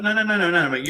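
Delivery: high, loud string of objections; retold indignant protest. Setting a troll straight in a retold row, indignant. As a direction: cut him off, retelling the row.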